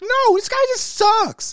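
high squeaky mocking voice; hammed-up high whiny hero; mock Cole Young.

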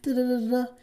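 high put-on voice imitating a complaining woman; mock whiny woman. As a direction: send up a woman moaning he never calls. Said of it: "she's crying about it".

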